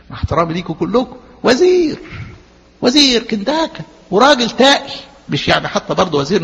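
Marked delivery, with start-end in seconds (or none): 1.4-4.9 high, emphatic minister praise; mock awe. Preacher teasing the congregation about a respectable minister, playful awe.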